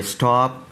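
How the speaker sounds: स्टॉप starting straight on st; vowelless initial s. Shown as a demonstration. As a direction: model स्टॉप with no vowel before or after s. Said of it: "सीन की आवाज़ है".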